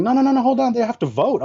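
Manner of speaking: high, hurried insistence; mock emcee insisting; insisting host. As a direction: hold him back, it isn't over. Hosts insisting.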